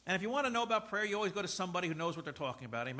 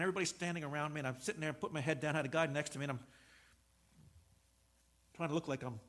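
usual speaking voice

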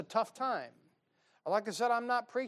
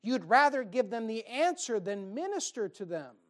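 normal talking voice

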